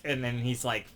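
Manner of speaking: normal talking voice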